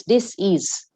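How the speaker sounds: "dis-ease" slowly split into dis and ease; slow split word. Shown as a demonstration. Teacher explaining disease to the audience, slow and split. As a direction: stretch "dis-ease" apart, dis, then ease. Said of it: "Dis-ease".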